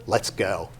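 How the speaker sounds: lightly voiced low, sly aside; scheming body part. Top half plotting against the bottom half, low scheming. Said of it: "The top of me said".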